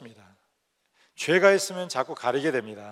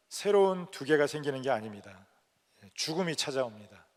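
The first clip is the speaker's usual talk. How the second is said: normal talking voice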